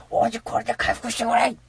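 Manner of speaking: high, cutesy cat sidekick line; cat handing over healing honey, in a high cutesy voice; cat companion voice. Pretend. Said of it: "本当のセリフは".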